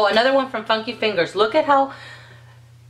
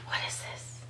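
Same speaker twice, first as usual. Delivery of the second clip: whisper; 16 dB quieter than usual. Real, not acted. Marvels at the bottle.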